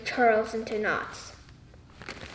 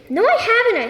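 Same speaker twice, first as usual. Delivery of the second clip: higher, louder retort; snapping; 10 semitones higher, 4 dB louder than usual. Acted. Mercy snapping back at her aunt, higher and sharp. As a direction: snap back defensively. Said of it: "I snap".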